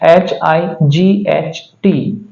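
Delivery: slow letter-by-letter spelling; एच आई जी एच टी spelled slowly, one letter at a time. Shown as a demonstration. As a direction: say each letter clearly and slowly. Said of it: "उसी तरह से".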